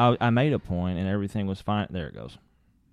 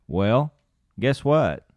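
ordinary talk, nothing marked

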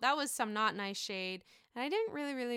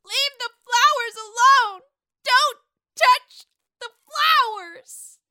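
put-on much higher, much louder, slow drunken shriek; drunk Sonia; 13 semitones higher, 16 dB louder, slower than usual. Host redoes Lou's quote as drunk Sonia shrieking. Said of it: "she would probably say Lou's quote just like this".

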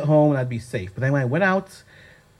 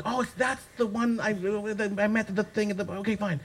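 much softer, muttered thinking to herself; quiet inner thought; 4 semitones higher, 10 dB quieter than usual. Retold or imitated. Working out who the host is, softly to herself. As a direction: talk it over to herself under her breath.

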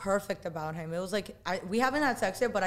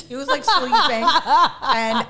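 loud, drawn-out laughing delivery; through laughter; 5 semitones higher, 10 dB louder, much slower than usual. Real, not acted. Telling host he slept through it, laughing. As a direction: stretch it out while laughing.

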